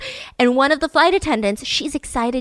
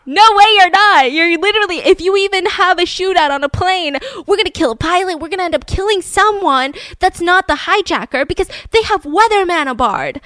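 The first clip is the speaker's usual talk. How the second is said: higher, louder captain voice, protesting; pitched-up exasperated pilot pleading his case; alarmed captain; 7 semitones higher, 4 dB louder than usual. Retold or imitated. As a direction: sell the pilot's panic about a shootout. Pilot arguing with the FBI.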